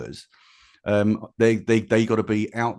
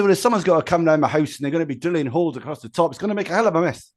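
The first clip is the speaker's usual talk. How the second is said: put-on Scottish accent; 7 semitones higher, 3 dB louder, faster than usual. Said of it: "I won't even attempt to do a Scottish accent".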